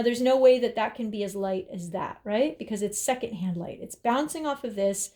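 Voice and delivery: ordinary delivery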